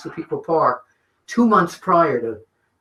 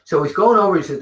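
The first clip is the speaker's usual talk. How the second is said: usual speaking voice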